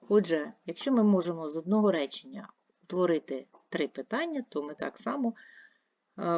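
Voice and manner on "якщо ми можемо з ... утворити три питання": ordinary talk, nothing marked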